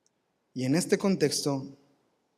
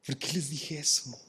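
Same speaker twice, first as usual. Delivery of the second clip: softer self-reproach; regretful muttering; 7 dB quieter than usual. Pretend. Paul blaming himself in his cell, soft regretful muttering. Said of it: "Pablo diciendo".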